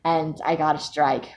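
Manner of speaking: ordinary delivery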